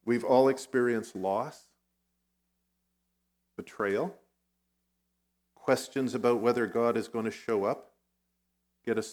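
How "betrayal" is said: ordinary talk, nothing marked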